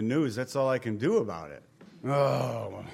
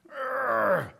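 groan; 8 semitones higher than usual. Retold or imitated. Overwhelmed person groaning in prayer.

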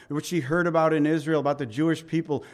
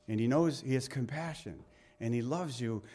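ordinary talk

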